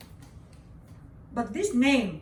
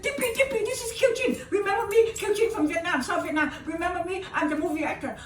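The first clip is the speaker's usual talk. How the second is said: desperate pleading; 10 semitones higher, 6 dB louder, faster than usual. Retold or imitated. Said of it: "I said".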